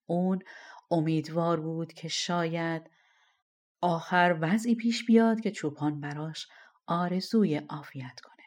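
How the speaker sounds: plain reading voice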